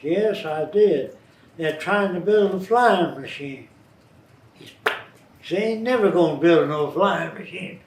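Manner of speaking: imitating a stubborn country farmer; loud country farmer voice; farmer accent. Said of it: "That's the way they talk".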